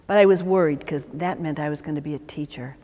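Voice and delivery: ordinary talk, nothing marked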